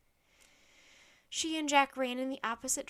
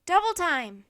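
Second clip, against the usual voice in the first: loud, raised hurry-up order; urgent shout; 6 semitones higher, 13 dB louder than usual. A narrator's reading. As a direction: shout her onward. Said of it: "Jack shouted to Annie".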